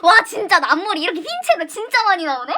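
usual speaking voice

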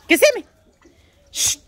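high, loud call across the yard. Real, not acted. Woman calling her animals from across the yard, loud high call.

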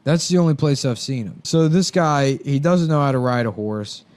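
ordinary delivery

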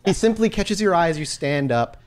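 ordinary delivery

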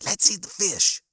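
high squeaky little voice; squeaking hunger pain. A narrator's reading. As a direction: squeak it like a tiny nagging creature. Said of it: "a little hunger pain squeaking".

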